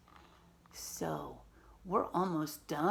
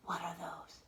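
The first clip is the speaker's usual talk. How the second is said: hushed wonder; 6 dB quieter than usual. Acted. Reading picture book to kids.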